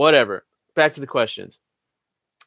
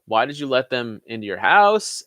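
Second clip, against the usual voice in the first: normal talking voice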